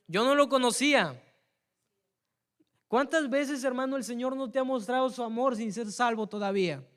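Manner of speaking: loud, high impassioned altar call; fiery preaching. Real, not acted. Preacher calling the congregation to come to the Lord. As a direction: plead with the church at full force.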